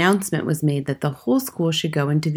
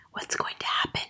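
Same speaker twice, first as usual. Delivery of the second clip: hushed, unvoiced child's whisper; Lavender's whisper; 11 dB quieter than usual. A narrator's reading. Girl to her friend in assembly, whispered. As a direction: whisper it under your breath. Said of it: "Lavender whispered".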